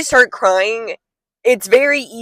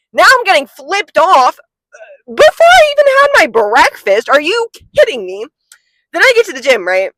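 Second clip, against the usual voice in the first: exasperated rant; 5 semitones higher, 3 dB louder than usual. Real, not acted. Ranting about being flipped off.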